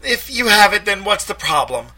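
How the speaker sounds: high, loud snap; defensive Ruth. A narrator's reading. Ruth snapping back at the detective, high and loud. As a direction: push back hard.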